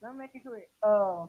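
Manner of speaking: faint, distant phone voice; barely audible. Real, not acted. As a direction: caller far from the mic. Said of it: "but you sound very faint".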